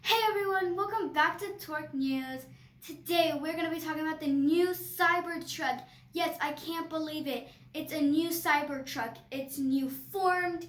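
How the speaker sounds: loud, lifted YouTuber intro; peppy host demo. Shown as a demonstration. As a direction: show dad how to open with energy. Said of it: "So I would do, that would be like".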